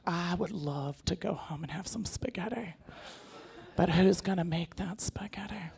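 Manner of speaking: slow, breathless whisper; wife whispering to husband, seductive. Retold or imitated. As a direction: whisper it like a secret invitation.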